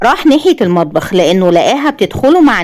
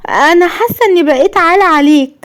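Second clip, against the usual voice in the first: higher, hurt and sorrowful; sad and bitter; 9 semitones higher than usual. A narrator's reading. Wife tells husband she is a burden, sadly. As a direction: say it feeling like a burden, pained. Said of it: "وقالت بحزن وقهر".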